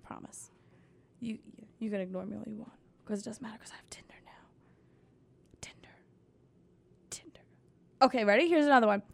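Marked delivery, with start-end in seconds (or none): 2.2-7.4 breathy whispered aside; real whisper, barely audible. Real, not acted. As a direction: whisper it secretly to yourself. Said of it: "whispered aside".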